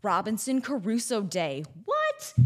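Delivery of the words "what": very high, rising squeak of disbelief; mock surprise. Host reacts to Robinson Crusoe Day, mock surprise. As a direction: play astonished, pitch shooting up.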